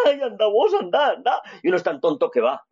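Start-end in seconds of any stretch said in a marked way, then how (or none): 0.1-1.4 scared woman. Retold or imitated. Girlfriend on a dark road urging the man on, high and pleading. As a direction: push him forward in mock fright.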